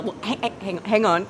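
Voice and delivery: usual speaking voice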